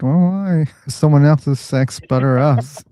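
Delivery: normal talking voice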